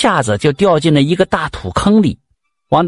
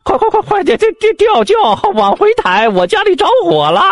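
much higher, panicked shouting; loud shout. Acted. Swindler shouting to the bearers to turn back, high alarmed yell. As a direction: yell in alarm from the sedan chair. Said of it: "冷不丁就大声喊".

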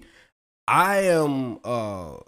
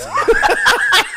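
high, loud burst of laughter; 8 dB louder than usual. Real, not acted. Telling friends about the money, loud high burst of laughter. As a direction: crack up hard.

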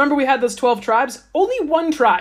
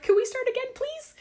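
much higher, soft small voice; meek plea; 6 dB quieter than usual. Retold or imitated. Israelites begging Moses the morning after, tiny meek plea. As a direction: plead like a sheepish child.

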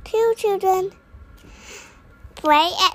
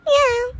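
much higher louder meow; cat meow; 9 semitones higher, 5 dB louder than usual. Acted. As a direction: protest like the cat. Cat kept away.

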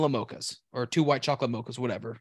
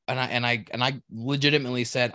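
ordinary delivery

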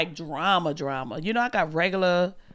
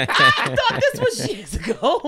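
high, slow, laughing disbelief; stunned laugh as the news lands; shocked disbelief; 4 dB louder than usual. Real, not acted. Co-host hearing the fall was days ago, laughing in disbelief.

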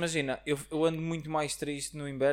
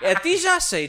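loud teasing; 12 semitones higher, 9 dB louder than usual. Real, not acted. Teasing a friend on mic.

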